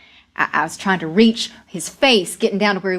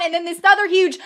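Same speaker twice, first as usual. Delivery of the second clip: high, heightened cry of disaster; alarmed climax of a tale; urgent storytelling; 10 semitones higher than usual. A narrator's reading. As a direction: carry the panic through the second cave-in. Telling the second collapse.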